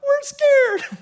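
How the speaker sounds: tiny, very high squeaky voice of a frightened soul; scared soul. Retold or imitated. David's soul answering him in a tiny high scared voice. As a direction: pitch it way up like a timid little soul. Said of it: "his soul talks back".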